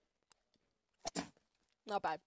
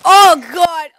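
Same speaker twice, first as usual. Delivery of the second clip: loud pained yelp; 5 semitones higher, 14 dB louder than usual. Real, not acted. Reacting to burning spicy chip.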